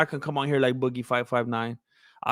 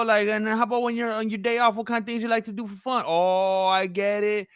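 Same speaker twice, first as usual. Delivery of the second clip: higher, fast, friendly sales patter; slick used car salesman; 6 semitones higher than usual. Acted. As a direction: chat up a customer to sell a car. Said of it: "your car salesman voice".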